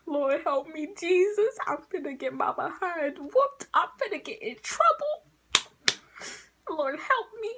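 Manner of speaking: high, pleading mock prayer; mock panic prayer. Teen retelling her panicked prayer before a whooping, high. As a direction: beg the Lord like trouble is coming. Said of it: "I was like".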